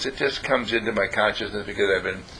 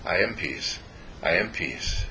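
soft, lower mantra repeated gently; calm self-affirmation; 5 semitones lower, 7 dB quieter than usual. Shown as a demonstration. Relaxing in the evening, soft murmured mantra. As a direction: let each one sink softer than the last. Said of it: "you say".

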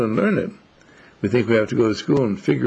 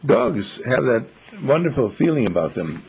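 ordinary delivery

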